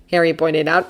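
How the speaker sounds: ordinary delivery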